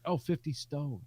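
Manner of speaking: light put-on British voice; a hint of British accent; mock British accent. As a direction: toss it off like a Brit. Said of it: "And I just say it in Brit-, British accent".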